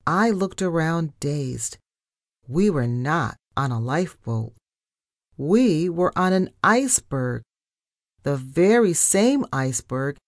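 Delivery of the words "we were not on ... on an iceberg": ordinary delivery, nothing marked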